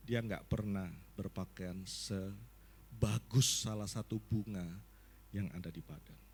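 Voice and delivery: low, calm, unhurried reassurance; voice of God. Retold or imitated. God reminding the pastor about Solomon, low and gentle. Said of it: "Terus Tuhan ngomong kayak gini".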